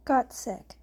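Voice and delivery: ordinary delivery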